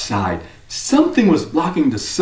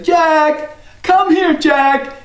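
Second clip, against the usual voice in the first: mother calling; 12 semitones higher, much slower than usual. Acted. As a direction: call him in as his mom. Mother calling her son.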